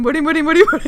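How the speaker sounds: frantic refusal. Retold or imitated. Nagano refuses the climb, loud frantic muri. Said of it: "And then".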